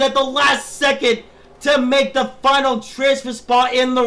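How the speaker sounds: shouting, excited live play-by-play; race commentator. Acted. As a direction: call the race at full hype.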